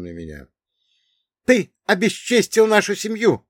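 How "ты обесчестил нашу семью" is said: high, loud accusation; accusing shout. Acted. Second intruder accusing the narrator, high shout. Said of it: "крикнул второй".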